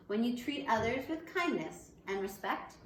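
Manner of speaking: normal talking voice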